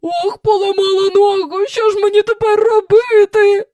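high, loud lament; wailing. Acted. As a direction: wail it out in a put-on voice. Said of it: "заголосила вона".

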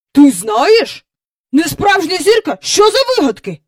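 high, loud, incredulous questions; indignant disbelief. A narrator's reading. Mister Banks cutting Michael short, incredulous indignant questioning. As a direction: throw the claim back as a question.

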